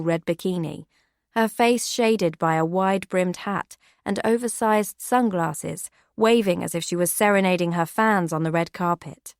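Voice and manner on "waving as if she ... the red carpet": ordinary delivery, nothing marked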